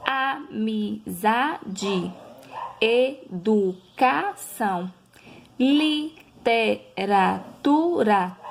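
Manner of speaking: literatura stretched out syllable by syllable; slow syllable by syllable. Shown as a demonstration. Teacher to pupils, slow syllable-by-syllable example words. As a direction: sound out each syllable slowly.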